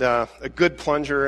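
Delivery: ordinary talk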